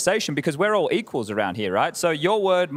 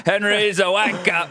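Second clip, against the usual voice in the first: mock Aussie jab; slower than usual.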